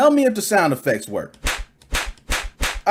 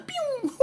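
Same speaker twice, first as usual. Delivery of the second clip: ray gun noise; 11 dB quieter than usual. Pretend.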